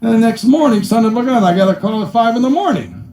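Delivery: normal talking voice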